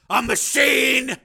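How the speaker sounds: drawn-out, slow and loud; bitter shout. Acted. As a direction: Steele spitting out what she won't say, slow loud bitter shout. Steele finishes her sentence bitterly.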